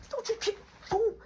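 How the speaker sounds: high pitched sharp warning; warning the guy kicking Marky, high and sharp; retold angry warning. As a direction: stop him cold mid-kick. Grabbing the attacker mid-fight.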